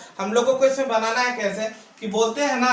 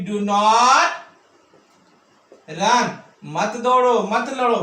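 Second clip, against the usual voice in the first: very slow, NOT stretched and louder; slow emphatic model; 4 dB louder, much slower than usual. Shown as a demonstration. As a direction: dictate the command word by word. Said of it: "teacher models the sentence". (धीरे और ज़ोर देकर)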